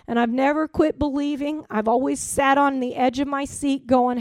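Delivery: usual speaking voice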